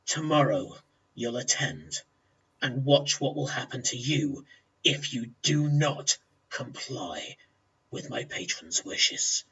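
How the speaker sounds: low, quiet orc threat; hushed, deep menace fading almost to breath; menacing in the ear. Acted. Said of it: "brings his heavily tusked mouth down to her ear".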